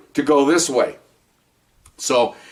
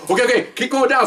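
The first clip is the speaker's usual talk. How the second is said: urging; 10 semitones higher than usual. Retold or imitated. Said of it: "So I go".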